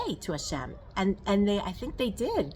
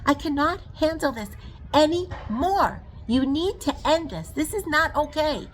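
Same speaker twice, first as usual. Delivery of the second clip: retold outburst, louder and higher, slowing on "anymore"; angry outcry; 7 semitones higher, 5 dB louder than usual. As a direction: cry out to God that it is too much. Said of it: "when I was screaming at Hashem".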